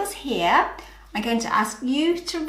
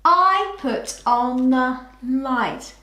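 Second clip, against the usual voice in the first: slow reread; 3 dB louder, slower than usual. Shown as a demonstration. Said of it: "finished sentence read back".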